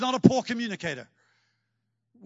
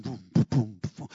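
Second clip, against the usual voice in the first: imitated thuds; 11 semitones lower than usual. Imitating fish hitting the net.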